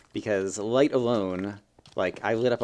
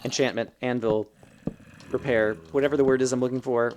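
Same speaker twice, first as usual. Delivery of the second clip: normal talking voice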